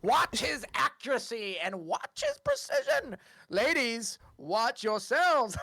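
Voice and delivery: stretched-out showman's announcement; grand, slow ringmaster patter; circus ringleader. Acted. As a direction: draw out every big word for the crowd. Ringleader announcing the first act.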